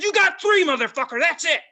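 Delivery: high, loud, threatening; furious shouted warning; retold yell. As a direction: hurl the threat at him. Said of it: "I was just yelling".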